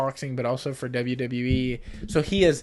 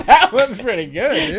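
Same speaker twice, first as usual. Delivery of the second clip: high laughing words; 11 semitones higher, 11 dB louder than usual. Real, not acted. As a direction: laugh while teasing. Laughing at friend's accent.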